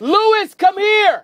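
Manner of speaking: high-pitched summoning holler; calling out. Real, not acted. Radio host summoning a colleague after the quake, calling out. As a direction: call them over from across the station.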